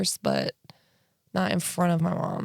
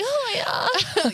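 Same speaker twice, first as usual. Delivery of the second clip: mock moan.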